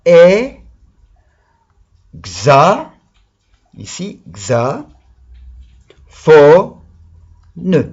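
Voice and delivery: "fo" punched loud, slow beats; syllable by syllable. Shown as a demonstration. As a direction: hammer each syllable. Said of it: "syllable correction with pupils".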